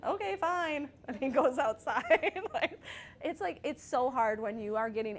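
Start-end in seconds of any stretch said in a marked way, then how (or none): 0.1-0.9 high-pitched, playfully huffy reply; mock huffy dismissal. Mom answers son who is running away, mock huffy. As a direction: call the bluff, pitched well up. Retells parent's sparring reply.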